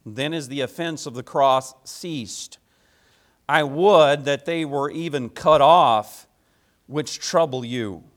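slow, solemn reading of a Bible passage; scripture reading. Acted. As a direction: read the verses measured and weighty, with pauses. Reads scripture before the sermon.

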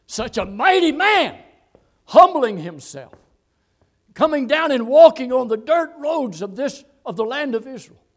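loud, high, exclaiming shout; full-force preaching. Real, not acted. Preacher marvelling at mighty man humbling himself, shouting. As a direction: thunder it out in wonder.

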